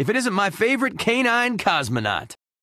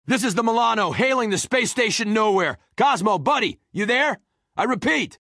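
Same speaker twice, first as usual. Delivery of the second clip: high, pressing call; urgent radio hail; 7 semitones higher than usual. Acted. Milano pilot hailing Cosmo at Nowhere, urgent hail.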